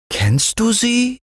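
put-on very high, eager child question; fascinated little boy; burning interest. A narrator's reading. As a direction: ask as if bursting to know. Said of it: "fragte Fritz mit brennendem Interesse".

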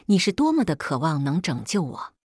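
plain reading voice

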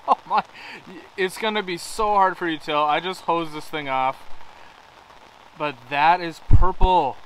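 real delighted surprise; excited. Real, not acted. Surprised by amethyst.

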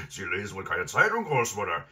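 loud, gruff villain taunt; mocking robber. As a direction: jeer loudly at the old lady. Robber mocks grandmother after laughing.

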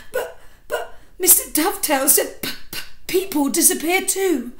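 put-on high, stammering child voice near tears; frightened little boy. Little boy sobbing to his mother after a nightmare, high and stammering. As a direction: stutter through the fear like a sobbing five-year-old.

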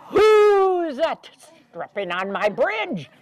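loud troll challenge; troll hears the medium goat. Acted. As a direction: bellow like the troll. Troll calls out.

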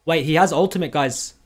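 loud, sudden alarm; excited shout. Real, not acted. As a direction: call it out as the fight turns. Caster reacts to sudden fight.

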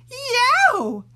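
high loud yelp of pain. Acted. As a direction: cry out as the hair is yanked. Hair yanked, cries out.

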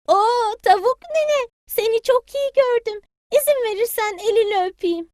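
high, sweet little chick; a polite child greeting granny. Acted. Payti politely greets granny hen, high child voice.

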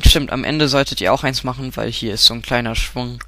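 ordinary talk

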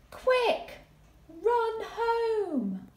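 high, loud panicked call to flee; urgent cry. Shown as a demonstration. Teacher acting the escape, high and urgent. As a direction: shout it like you're running for home. Story climax, fleeing tortoise.

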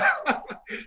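high airless burst of laughter; breathy laugh. Real, not acted. Recalling the prank on the rookie, airless laugh. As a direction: crack up silently at the memory.

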